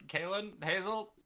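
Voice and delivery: calling out loudly for help; shouting names. Acted. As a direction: yell their names across the deck. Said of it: "and I just shout".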